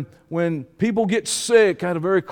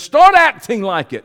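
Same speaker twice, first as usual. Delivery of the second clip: raised, loud command; forceful order; 4 semitones higher, 7 dB louder than usual. Retold or imitated. Preacher retelling Yahweh's rebuke, loud and commanding. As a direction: hit it hard and higher, as an order.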